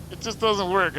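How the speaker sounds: exasperated outburst. Real, not acted.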